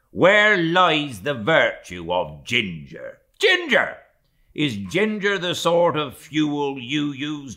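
mate mocking the steward over ginger, loud and scornful. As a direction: rail at the steward with derisive questions. Stubb berates the steward.

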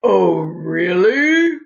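loud, slow, booming lion roar; roaring Daddy Lion. A narrator's reading. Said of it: "roared Daddy Lion".

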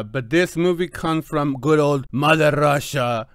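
put-on just hint at a Russian accent on Mother Russia; mock Russian accent. Reviewer introducing a Russian film, lightly mocking a Russian accent. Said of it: "I should try to do this all in a Russian accent".

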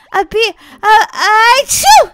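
sneeze. Acted.

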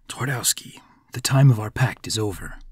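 lightly voiced devil. A narrator's reading. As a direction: quietly announce it as a devil calling in a debt. Devil addresses the magician.

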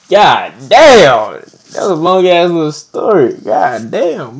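loud, stunned exclamation; shocked outburst at the story; loud disbelief. Real, not acted. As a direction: blurt it out in disbelief.

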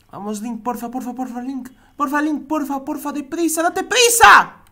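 rising, pleading, growing to loud; desperate pleading. Real, not acted. Begging character to hurry.

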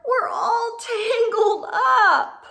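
high, slow, laughing plea; tangled friends' cry. Acted. Friends stuck together calling to Squirrel, laughing plea. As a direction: call out, half laughing.

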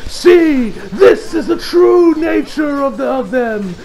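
rising shouted villain rant; evil boss ordering the kill, rising to a shout; villain's rant. Retold or imitated. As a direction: rant at the soldiers, building to a yell. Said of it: "And he says".

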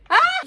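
short, high, loud shriek; startled scream. Real, not acted. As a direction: yelp as if suddenly caught. Oyunda irkilip bağırıyor. (irkilmiş tiz çığlık)

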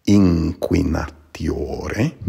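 "inquinatiore" said slowly with the first N as NG; NG before Q. Shown as a demonstration. Teacher modeling the nasal assimilation, slow and careful. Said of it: "more like an N G sound".

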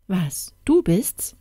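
plain reading voice

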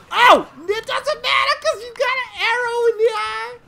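high, loud, laughing gloat; high gloating yell. Real, not acted. Gamer gloating over a kill, high and loud.

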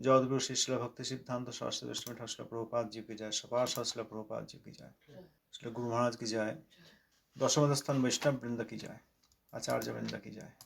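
low, soft, rapid ritual recitation; hushed formulaic praise to the gurus; recited invocation. Real, not acted. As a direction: murmur the salutations quickly, by rote. Recites invocation of victory calls.

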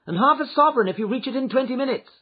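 high, loud urgent offer; urgent cry. A narrator's reading. Lady urging her coachman on, crying out. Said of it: "she cried".

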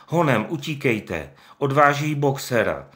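desperate call to the other animals; shouting. Acted. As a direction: raise the alarm loudly. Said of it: "křičel".

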